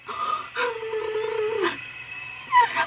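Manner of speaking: high crying gasp; a sob catching in the throat; tearful gasp. Real, not acted. Watching skating, overwhelmed.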